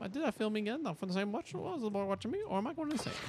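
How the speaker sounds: high, anxious read-aloud voice. Acted. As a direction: read it like a nervous diary. Reads quest text aloud.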